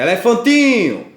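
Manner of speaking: high, loud shout of the word; demonstrative shout. Shown as a demonstration. Teacher showing pupils the shouting rule, demonstrative shout. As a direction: shout it out to expose the strongest syllable. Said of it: "você grita".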